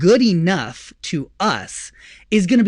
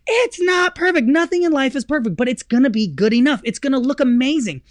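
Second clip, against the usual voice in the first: higher, heated insistence; mock outburst; 6 semitones higher than usual. Husband snaps back at wife, worked up. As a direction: play it as a worked-up rebuttal.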